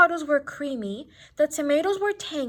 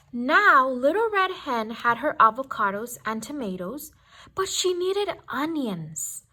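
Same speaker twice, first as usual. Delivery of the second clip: ordinary delivery, nothing marked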